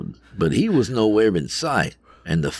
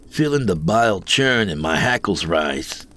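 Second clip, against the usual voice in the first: ordinary delivery, nothing marked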